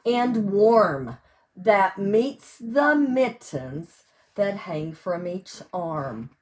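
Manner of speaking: loud, sing-song picture-book reading; reading to children. Acted. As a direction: read each page big and bright for little listeners. Reads picture book aloud.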